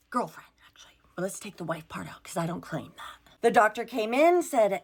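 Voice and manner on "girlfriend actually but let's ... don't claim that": whispered quick aside. Real, not acted. Aside correcting herself.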